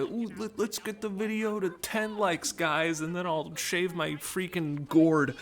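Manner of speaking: high, slow mock-eager voice begging for likes; mocking begging youtuber. Mocking a like-begging youtuber to viewers, high and slow. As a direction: sneer at the like-begging creator. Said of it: "This isn't like a".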